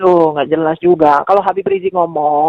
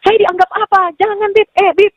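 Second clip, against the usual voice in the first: raised, higher pleading complaint; replaying the argument, voice pitched up; retold heated quarrel; 10 semitones higher than usual. [name] reenacting her quarrel with Habib. (memerankan pertengkaran dengan nada tinggi)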